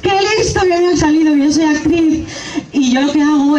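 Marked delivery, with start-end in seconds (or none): 0.0-1.4 high-pitched doting mum; proud gushing. Acted. Mother boasting about her clever son, high proud gushing. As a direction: gush over your clever child in a higher voice.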